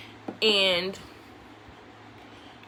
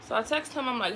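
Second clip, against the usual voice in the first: ordinary delivery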